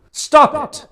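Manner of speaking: sharp, high, loud shout. A narrator's reading. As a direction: cut him off with a yell. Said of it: "I shouted telepathically".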